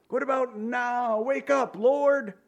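high, insistent wake-up cry; mock desperate plea. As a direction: call up to a sleeping God. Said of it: "the response of the people is".

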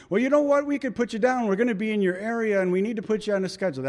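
high-pitched salesman, speeding up as he presses on; pushy salesman. Retold or imitated. Salesman pressing customer to book service, high quick pitch.

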